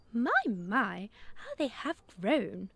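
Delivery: a cheerful little bird exclaiming; chirpy Dove. A narrator's reading. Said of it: "she chirped".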